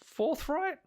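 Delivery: put-on high, fast sheepish young woman; apologetic high character voice; Nessie voice.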